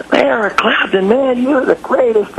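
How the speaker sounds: high-pitched starstruck praise; gushing fan. Retold or imitated. Fan greeting [name] backstage, gushing. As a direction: gush at your idol, pitch jumping up.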